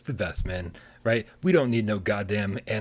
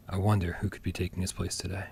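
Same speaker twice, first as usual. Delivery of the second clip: low dramatic voice; 5 semitones lower, 5 dB quieter than usual. Shown as a demonstration. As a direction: tease it like a mystery trailer. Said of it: "start talking like this".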